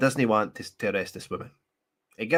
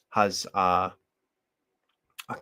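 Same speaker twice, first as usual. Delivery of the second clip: ordinary talk, nothing marked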